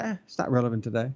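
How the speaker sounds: quick, low, throwaway brush-off; dismissive. Acted. Christians looking at God's word, dismissive. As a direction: toss it off as unimportant. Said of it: "They're discounting the statutes of God".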